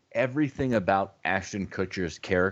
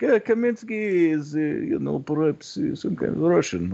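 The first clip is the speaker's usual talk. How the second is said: higher, hesitant fake Russian accent; mock Russian accent; 6 semitones higher than usual. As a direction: imitate an actor fumbling a Russian accent. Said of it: "Hector Alonso was like".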